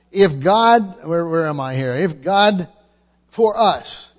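usual speaking voice

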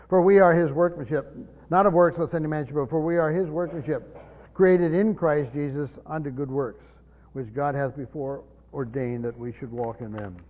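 steady recitation of a memorized verse; scripture recitation. Acted. Preacher restarting Ephesians for the class, measured recitation. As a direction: say the passage as sacred text.

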